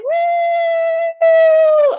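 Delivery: a very loud, very high celebratory woo; triumphant whoop. Real, not acted. Host cheering the new hee-haw champion, very loud and high. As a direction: cheer the champion at full blast.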